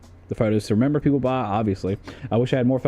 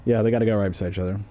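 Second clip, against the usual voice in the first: normal talking voice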